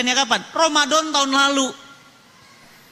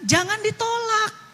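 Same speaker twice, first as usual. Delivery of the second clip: ordinary talk, nothing marked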